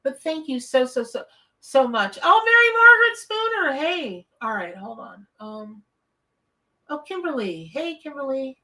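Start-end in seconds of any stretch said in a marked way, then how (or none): 2.2-4.2 delighted greeting. Real, not acted.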